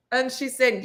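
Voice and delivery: ordinary delivery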